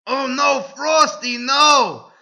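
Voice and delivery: high, loud mock pleading; overacted high protest; hammed-up cry of protest. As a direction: cry out like a melodramatic heroine. Heroine cries out to Frosty.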